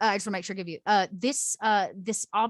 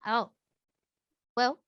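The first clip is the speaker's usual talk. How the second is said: ordinary talk, nothing marked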